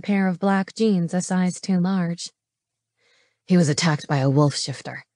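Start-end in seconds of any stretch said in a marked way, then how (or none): 3.5-5.1 low, terse male character voice; mild growl. A narrator's reading. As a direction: answer with a lightly growled, lowered voice. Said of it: "Nick growled".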